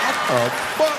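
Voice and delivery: high, loud disbelieving outburst; shocked. Retold or imitated. Husband stunned she opened his phone, shocked. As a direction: blurt it in disbelief.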